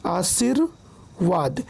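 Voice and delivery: आशीर्वाद slow, broken after the र; split pronunciation. Shown as a demonstration. Teacher showing reph is heard first, आशीर्वाद slowly split. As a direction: say आशीर, pause, then वाद for आशीर्वाद. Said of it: "उच्चारण पहले होता है".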